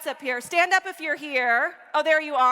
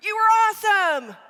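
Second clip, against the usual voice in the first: a big, loud, higher over-the-top "awesome"; shouted praise; 8 semitones higher, 8 dB louder than usual. Retold or imitated. Parent over-cheering the worst kid, louder and higher. Parent overpraising a bad player.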